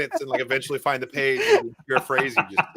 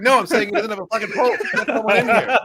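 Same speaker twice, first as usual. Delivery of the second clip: higher and louder, half laughing; mock urgent yell; 8 semitones higher, 5 dB louder than usual. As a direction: play a panicked emergency call with a laugh. Acted panic in medical scene.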